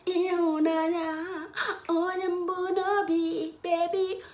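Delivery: high, slow, sing-song coo; drawn-out lovey-dovey coo; sweet cooing endearment. Acted. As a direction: croon it like calling your lover.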